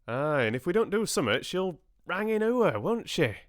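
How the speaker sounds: high-pitched country dialect; rustic farmer. A narrator's reading. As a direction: press the point like a hearty farmer.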